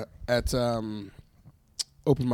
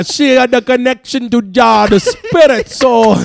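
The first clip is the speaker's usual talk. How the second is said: put-on high, loud Jamaican accent; 7 semitones higher, 5 dB louder than usual. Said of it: "we do great Jamaican accents".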